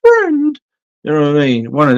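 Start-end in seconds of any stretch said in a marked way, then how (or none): 0.0-0.6 very high, silly squeak of "Friend"; a falsetto "Friend" with a falling tune; In Betweeners impression. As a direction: imitate the sitcom character's daft squeal. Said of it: "a little bit like In Betweeners".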